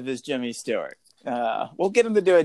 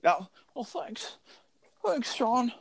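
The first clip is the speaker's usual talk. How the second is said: Jodie Foster impression; 9 semitones higher, 3 dB quieter than usual. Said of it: "I don't have a good Jodie Foster".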